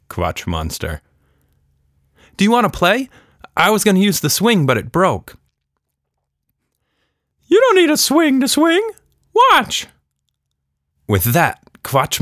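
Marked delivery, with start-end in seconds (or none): 2.4-5.4 put-on higher, louder, quick girl voice; eager Tilda. A narrator's reading. Tilda inviting the creature to play, eager girl voice. 7.5-9.9 very high squeaky creature voice; Quatch Monster voice. A narrator's reading. Creature telling Tilda its name, very high squeaky voice.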